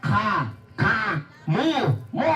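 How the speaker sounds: "Ka, ka, mu, mu" split into halting syllables, then "kamusta po kayo" rushed fast; coaching the puppet to copy each sound. Shown as a demonstration. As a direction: stammer the start, then race through. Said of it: "gayahin mo ko".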